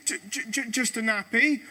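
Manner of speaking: ordinary delivery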